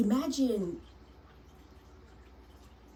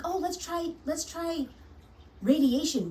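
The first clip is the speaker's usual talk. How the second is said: high, breezy mock doctor voice, radiation louder; 8 semitones higher, 4 dB louder than usual. Mocking doctors who push radiation on grandpa, high and breezy. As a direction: lightly ham up a cheerful doctor pushing treatment. Mocks doctors' eager suggestion.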